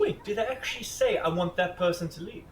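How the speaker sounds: high, loud disbelief; outraged little gasp of a question; indignant surprise. Real, not acted. Shocked at villager's request.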